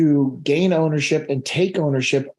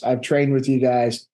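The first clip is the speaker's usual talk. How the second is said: ordinary delivery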